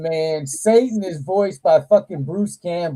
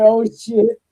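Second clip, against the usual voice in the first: surprised; 7 semitones higher, 5 dB louder than usual. Retold or imitated. Shock on hearing heaven doesn't exist.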